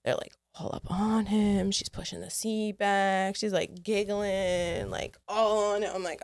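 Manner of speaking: ordinary talk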